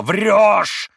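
high, loud enraged yell; furious yell. A narrator's reading. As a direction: roar at the lying maid. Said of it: "вскричал Митя".

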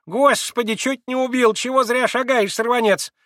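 put-on high, loud startled old crone voice; squealing old woman. A narrator's reading. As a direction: shriek at him like a startled old servant. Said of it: "Раздался пескливый вой какой-то старушонки".

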